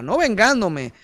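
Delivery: ordinary talk, nothing marked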